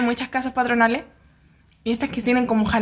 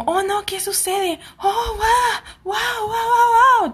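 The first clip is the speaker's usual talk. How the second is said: much higher, wailing mock crowd cry; 10 semitones higher, much slower than usual. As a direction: ham up a Korean drama crowd howling in panic. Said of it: "Típico drama coreano cuando mucha gente se junta".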